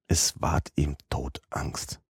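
breathless whisper of mortal fear; a slow, fearful whisper; hushed dread. A narrator's reading.

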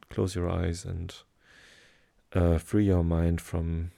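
ordinary talk, nothing marked

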